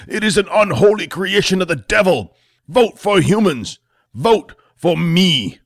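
fire-and-brimstone senator, slowing on the final slogans; rising voice. Acted. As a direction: whip up the crowd until you're nearly yelling. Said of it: "His voice started to rise".

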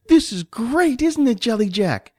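put-on high, excited child voice; delighted little boy. Johnny plays tanks with his friend, delighted. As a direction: bubble over like a small boy at play.

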